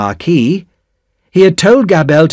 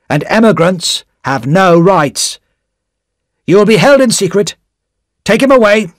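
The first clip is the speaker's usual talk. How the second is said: sharp; 7 semitones higher, 4 dB louder than usual. A narrator's reading. Official answering Darnay's protest, sharply. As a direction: cut him off sharply. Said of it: "said the official sharply".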